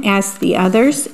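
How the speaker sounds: plain reading voice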